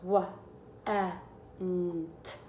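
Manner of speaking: "Wa, eh, n, t" split into separate sounds; teacher segmenting a word; sounding out. Shown as a demonstration. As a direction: sound out each letter apart. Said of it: "I hear four sounds".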